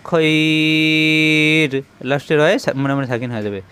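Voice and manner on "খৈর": খৈর with the ai drawn out very long; stretched madd-e-lin. Shown as a demonstration. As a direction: hold the vowel in খৈর for two alifs. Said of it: "তখন দুই আলিফ টানতে হয়".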